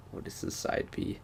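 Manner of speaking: unvoiced muttered question to self; whispering to himself. Real, not acted. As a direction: whisper it as you try to remember. Musing to himself mid-review.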